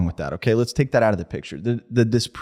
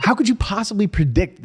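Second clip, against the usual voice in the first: higher, exasperated challenge; outraged disbelief; 9 semitones higher than usual. Real, not acted. Co-host disputing pandemic prediction, outraged disbelief.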